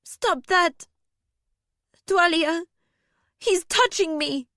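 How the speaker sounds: high, alarmed protest; outraged and terrified. A narrator's reading. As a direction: cry out for help in outrage. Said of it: "Alaria was outraged and terrified".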